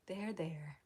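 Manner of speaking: soft, hushed comforting, fading to a whisper; soothing there there. Pretend. To a crying stranger, hushed soothing murmur. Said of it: "Be like".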